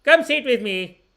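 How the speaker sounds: high, loud yell from downstairs; yelling uncle. Retold or imitated. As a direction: call up from downstairs. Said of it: "He'd yell from downstairs".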